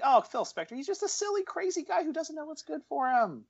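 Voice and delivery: very high, silly excusing voice; put-on high-pitched naive defence; mock apologist. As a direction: make a mock plea for the poor guy. Mimics film's excuse for Spector.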